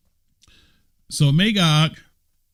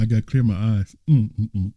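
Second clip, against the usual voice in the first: normal talking voice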